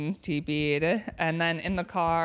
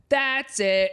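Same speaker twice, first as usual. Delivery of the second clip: high, loud snap; angry; 8 semitones higher, 5 dB louder than usual. Retold or imitated. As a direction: snap it, fed up. Kid snaps, acted quote.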